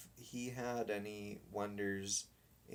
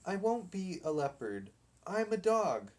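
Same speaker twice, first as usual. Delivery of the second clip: high, louder insistence; indignant dog; 7 semitones higher, 4 dB louder than usual. Acted. Dog insists to the boys he is a dog. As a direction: dig in and refuse.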